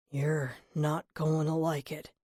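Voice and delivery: slow, lightly gruff murmur. A narrator's reading. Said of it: "Vance murmured in a gruff voice".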